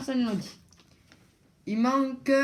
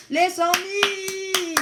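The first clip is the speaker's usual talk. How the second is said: dramatic announcement; 8 semitones higher, 4 dB louder, much slower than usual. Acted. Host teasing the top prize.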